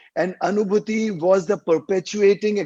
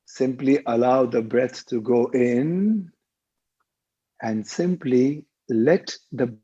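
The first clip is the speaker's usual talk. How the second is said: lower-pitched meditation voice with long pauses; guided meditation; 4 semitones lower than usual. Real, not acted. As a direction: guide listeners through each breath, drawing out the cues. Leads a guided breathing meditation.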